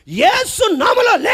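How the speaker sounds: high, loud command to rise; a ringing preacher's command; commanding shout. Retold or imitated. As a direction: call the dead up with authority.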